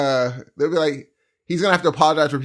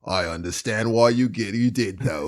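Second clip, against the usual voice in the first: low, calm, understanding; mock-placid boyfriend; chill boyfriend; 6 semitones lower, 4 dB quieter than usual. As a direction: play him mildly forgiving in a low voice. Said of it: "they're both so chill".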